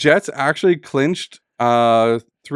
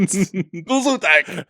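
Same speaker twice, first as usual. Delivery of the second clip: drawn-out, higher mock German accent; hammed-up German accent; put-on German accent; 5 semitones higher, much slower than usual. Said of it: "You changed your accent".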